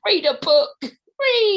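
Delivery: high, loud, drawn-out yell; exasperated shout. Real, not acted. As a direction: shout it at the listeners in comic despair. Exasperated climax of rant at listeners.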